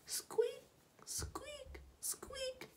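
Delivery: soft, very high squeaks; tiny squeaky voice up high; squeaking noise. A narrator's reading. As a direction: squeak along for the children to copy. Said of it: "make that noise again together".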